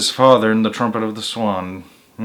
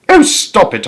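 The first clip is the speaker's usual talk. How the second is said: exasperated; 8 semitones higher, 5 dB louder than usual. A narrator's reading. Narrator annoyed on phone.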